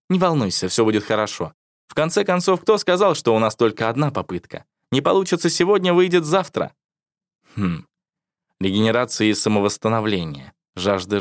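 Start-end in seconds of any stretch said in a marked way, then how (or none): 0.1-6.7 higher, gentle encouraging voice; reassuring wife. A narrator's reading.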